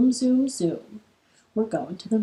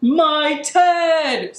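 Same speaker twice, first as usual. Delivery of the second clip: loud, higher-pitched storybook scream; bear's scream; 6 semitones higher, 5 dB louder than usual. A narrator's reading. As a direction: holler it out as the startled bear. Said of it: "screamed the bear".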